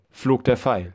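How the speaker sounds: usual speaking voice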